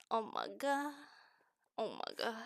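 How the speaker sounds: normal talking voice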